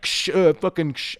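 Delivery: high "ksh" clatter noises with a muttered curse; imitated rattling. Imitating the spray paint cage door, noisy clatter. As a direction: mimic a metal door banging.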